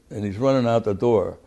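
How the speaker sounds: ordinary delivery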